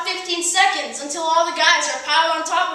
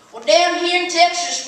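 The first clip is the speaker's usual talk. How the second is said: normal talking voice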